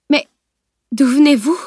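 flabbergasted, pitch jumping up; stunned utterance. A narrator's reading. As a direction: struggle to get the question out. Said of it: "proféra Madame Duciel".

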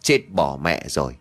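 a low, sudden gasp of shock; startled exclamation. Acted. Hến shocked at her own reflection, low exclaiming. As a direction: blurt it out in alarm at the mirror. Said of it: "mụ đã thốt lên".

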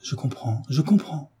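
hushed, dawning enthusiasm; generous enthusiasm. A narrator's reading. Frédéric tells David he understands, with generous enthusiasm. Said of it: "le cœur palpitant d'un généreux enthousiasme".

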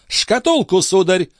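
very high feminine command; demanding countess. A narrator's reading. Countess flinging her demand at the police chief, high. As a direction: throw the order at him in high voice. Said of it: "бросила графиня".

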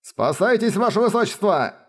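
high, urgent exclamation; exclaiming. Acted. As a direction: urge her to flee. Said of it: "восклицал Салавел".

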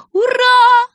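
high, loud cheer; yelling in triumph. A narrator's reading. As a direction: shriek hooray with delight. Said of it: "завопила она".

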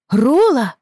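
high, loud shout of the name; loud call. Acted. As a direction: yell after the running dog. Рэйчел громко звала его. (громко зовёт собаку)

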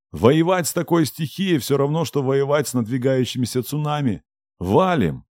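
urgent yell over the wind; shouting. Acted. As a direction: shout them toward the portal. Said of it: "стараясь перекричать рёв урагана".

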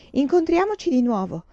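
high, triumphant farewell call. Acted. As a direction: crow it like a sale was won. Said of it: "gridò Pan Tai Tai trionfante".

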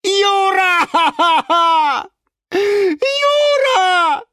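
extremely high, loud drawn-out call; screaming. A narrator's reading. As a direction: scream his name for rescue, stretching it. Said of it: "вслух снова прокричал". (истошный протяжный вопль)

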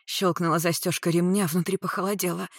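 plain reading voice

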